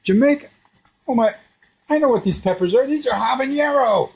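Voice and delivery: very high, incredulous outcry; mock-panicked disbelief; horrified realisation. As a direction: re-enact dawning alarm, voice shooting up. Re-enacts horrified realisation about the peppers.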